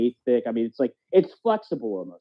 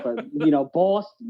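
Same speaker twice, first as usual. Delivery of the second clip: ordinary delivery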